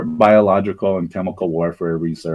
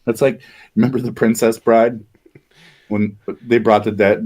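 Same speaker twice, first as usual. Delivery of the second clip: normal talking voice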